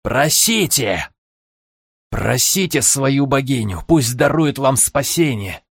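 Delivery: slow, gloating villain voice; mustache twirling villain. Acted. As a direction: savour every word like a villain. Villain taunting in his head.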